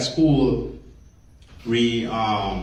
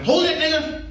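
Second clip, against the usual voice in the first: high-pitched yell of a pretend attacker; mock menacing shout; 4 dB louder than usual. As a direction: jump out and holler it, hammed-up. Imagined Klansman jumps out at him.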